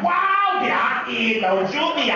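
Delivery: very high, loud excited squeal; hammed-up excitement; mock excited shout. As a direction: gush like an overexcited guy. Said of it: "We get very excited".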